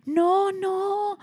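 hohe, beschwichtigende Frauenstimme; Nachbarin mit gebrochenem Englisch. Retold or imitated. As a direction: die Nachbarin hoch und beteuernd nachahmen. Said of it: "die kann halt kein Deutsch". (hoch nachgeahmte Nachbarin)

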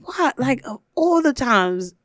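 high startled what; retelling his own shock at the KKK line. Retold or imitated. Friend retells his shock at the line, startled. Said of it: "And I was like".

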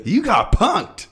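high, loud, drawn-out prankster voice; Ashton Kutcher. Acted. Co-host cutting in on the intro, high impression. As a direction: crow it high, stretched out. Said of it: "Why did I throw Ashton Kutcher?".

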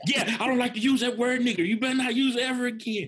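high mock coach voice. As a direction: ham up the hypocritical coach, high.

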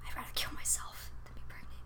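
hushed, dark admission; whispered confession. Retold or imitated. Telling coworkers, whispered dark joke. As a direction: whisper it flatly. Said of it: "I'm like".

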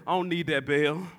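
put-on low, unbothered older father; nonchalant refusal. Father declining to ring the cancer-free bell, nonchalant. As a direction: wave it off in a low, easy voice. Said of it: "just all nonchalant".

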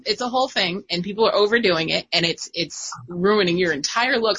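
ordinary talk

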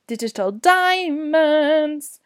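slow drawn-out name. Acted. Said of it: "Wow, that was weird".

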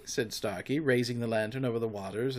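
ordinary delivery, nothing marked

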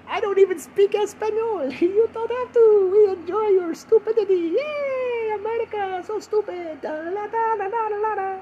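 high falsetto put-on foreign voice; foreign listeners. Imagined fans in Spain enjoying his stupidity, high foreign voice. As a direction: squeal it like a cartoon foreign fan. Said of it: "Spain. And you're like".

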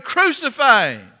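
high, loud mob cry; crowd shouting. Acted. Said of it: "And they shouted".

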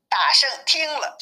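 usual speaking voice

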